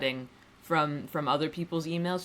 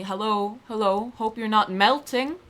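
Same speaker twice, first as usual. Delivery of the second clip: email voice; 5 semitones higher, 4 dB louder than usual. Shown as a demonstration. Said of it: "I've made an email voice".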